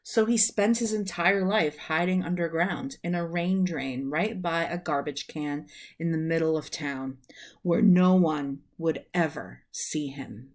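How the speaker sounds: usual speaking voice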